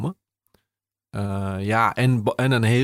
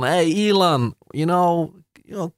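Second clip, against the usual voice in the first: higher, louder put-on English voice imitating a minister courting Elon; mock minister in English; 7 semitones higher, 4 dB louder than usual. Pretend minister coaxing Elon Musk, playful English put-on voice. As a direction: ham up a politician's sales pitch to Musk. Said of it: "misschien dat hij heeft gezegd van".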